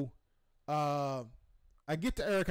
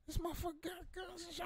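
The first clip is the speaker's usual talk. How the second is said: high, much softer secret aside; hushed alarm; 11 semitones higher, 11 dB quieter, faster than usual. Retold or imitated. Realizing the host has guns, hushed and high. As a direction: breathe it like nobody should hear.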